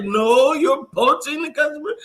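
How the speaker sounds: high-pitched retold comeback; mock indignant accusation. Acted argument over poaching a customer.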